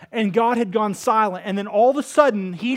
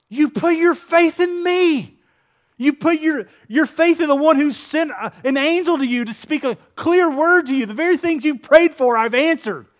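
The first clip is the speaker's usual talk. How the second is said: voiced angel; 6 semitones higher, 5 dB louder than usual. Angel telling Zechariah to trust, raised and insistent. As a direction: press the point home as the angel.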